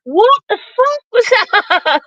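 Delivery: high, drawn-out baffled reaction; stretched-out, incredulous mimicry; mock disbelief. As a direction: imitate their stunned response, slow and high. Retelling the panel's stunned reaction.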